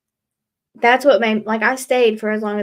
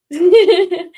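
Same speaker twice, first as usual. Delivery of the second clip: laugh; 9 semitones higher, 9 dB louder than usual. Real, not acted. Laugh after retelling.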